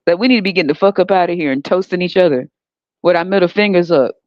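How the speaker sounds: ordinary delivery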